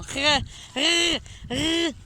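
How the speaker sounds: "rrr" as repeated high throat rasps; attempted throaty R. Shown as a demonstration. Learner failing at a German sound on camera, struggling throaty R. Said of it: "I can't do it at all".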